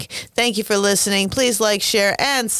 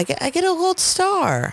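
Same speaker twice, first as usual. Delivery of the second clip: much higher gleeful squeal; 11 semitones higher than usual. Acted. Host rewarding herself a gold star in a high squeal. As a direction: celebrate like a kid. Self-congratulating playfully.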